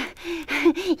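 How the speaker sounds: high gleeful giggle. A narrator's reading. Wife delighting in the gold, high giggle. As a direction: giggle greedily.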